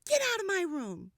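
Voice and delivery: shouted in a hurry; shouting. A narrator's reading. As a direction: yell at the baby to get out. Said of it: "So I shouted".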